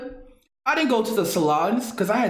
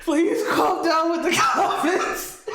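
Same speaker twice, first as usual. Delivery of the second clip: higher, laughing plea to stop; 6 semitones higher than usual. Real, not acted. Streamer begging chat to stop, laughing. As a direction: beg them to stop, cracking up. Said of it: "I can't laugh like this more".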